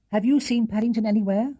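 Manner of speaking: a hint of a worried mother asking; anxious mother asking. A narrator's reading. Mrs Brown asks Mrs Bird.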